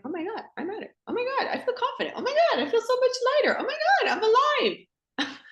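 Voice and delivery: high, giggling bursts of delight; breathless, laughing, giddy self-discovery; giddy rebirth joy. Retold or imitated. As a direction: squeal it like you just came alive. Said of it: "she's like".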